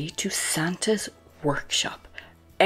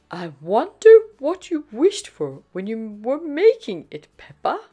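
higher, lilting, curious mother; Mummy Pig; 7 semitones higher, 8 dB louder than usual. A narrator's reading. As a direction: wonder aloud in a raised singsong. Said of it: "snorts Mummy Pig".

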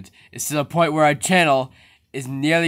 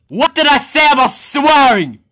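higher, louder furious question; angry yell; 9 semitones higher, 5 dB louder than usual. Acted. Boss to worker about the swearing rule, loud angry yell. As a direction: blow up at the worker. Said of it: "and what did I say about swearing?".

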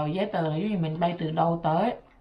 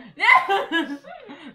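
loud, high burst of laughter; 14 semitones higher, 5 dB louder than usual. Real, not acted. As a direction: break into a squealing laugh. Laughing argument.

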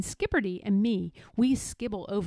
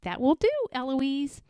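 higher, cheeky echo; mimicking the tutor; 4 semitones higher than usual. A narrator's reading. Eloise copying her tutor, higher and cheeky. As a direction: parrot the reprimand lightly. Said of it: "And I say".

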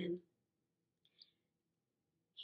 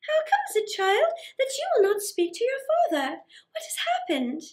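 much higher, motherly questioning voice; concerned queen mother; 9 semitones higher than usual. Acted. The queen asks her daughter why she won't speak, high and motherly. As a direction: coax the silent child gently.

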